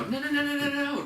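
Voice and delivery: very high, rapid-fire string of no's; urgent interrupting no-no-no. Acted. Trainer interrupting a puppy squatting indoors, urgent high scolding. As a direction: startle the puppy mid-act with high alarm. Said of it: "I'd clap and go".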